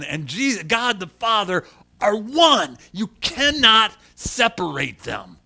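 loud, high, fervent preaching; full-force preaching. Acted. Pastor acting out Jesus and Philip for the church, loud. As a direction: preach the scripture with fire. Preaching the John fourteen dialogue.

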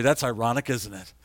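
ordinary talk

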